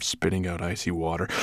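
ordinary delivery, nothing marked

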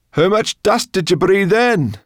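high, loud question of concern; anxious call. A narrator's reading. Sorrel questions the dragon about the dust, anxious call. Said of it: "Sorrel asked Firedrake anxiously".

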